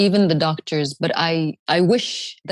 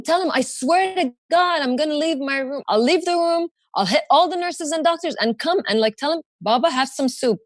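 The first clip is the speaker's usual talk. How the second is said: high, urgent pleading; worried daughter, pitch raised; desperate plea; 7 semitones higher than usual. Retold or imitated. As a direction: beg frantically to get through to him. Pleading over phone to mom.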